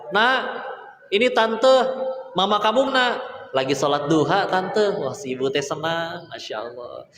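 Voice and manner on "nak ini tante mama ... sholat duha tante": higher, sweet auntie voice on the phone; a chirpy aunt asking for the mother; neighbour aunt on phone. Acted. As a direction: neighbour aunt phoning the child, higher and friendly. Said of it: "Ditanya sama nelpon".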